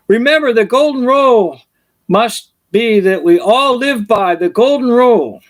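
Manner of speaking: high pompous royal decree; king's proclamation. Retold or imitated. King lecturing the peasants from his balcony, pompous.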